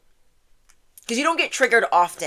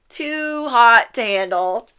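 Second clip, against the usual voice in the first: slow, higher, stretched "Too hot to handle"; playful drawn-out; 4 semitones higher, 5 dB louder, slower than usual. Real, not acted. Reader relishes the affirmation for viewers, playfully drawn out. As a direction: draw out TOO and HOT, raised and louder.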